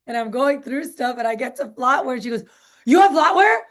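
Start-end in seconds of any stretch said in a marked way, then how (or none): 2.9-3.6 much higher and louder, thrilled surprise; loud excited question. Retold or imitated. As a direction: burst out, delighted at the news. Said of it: "she got, like, real loud and real excited".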